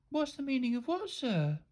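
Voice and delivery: soft, high feigned innocence; quiet little innocent question. A narrator's reading. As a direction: ask sweetly, as if clueless. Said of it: "asked Rebel innocently".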